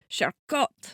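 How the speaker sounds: Charcot with a French accent, final vowel like "ah". Shown as a demonstration. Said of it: "That was my French accent".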